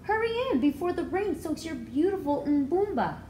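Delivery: lightly voiced grandmother calling out. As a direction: call the child in out of the rain like a caring grandmother.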